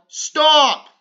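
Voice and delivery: sudden high, very loud yell. Acted. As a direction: shout to stop her hand. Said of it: "Arthur yelled".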